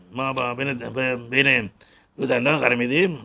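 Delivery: normal talking voice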